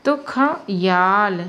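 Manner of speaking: ख याल said slowly, split ख then याल with stress on या; slow demo. Shown as a demonstration. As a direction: pull ख and याल apart, leaning on या. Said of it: "या को जोर दे दिए".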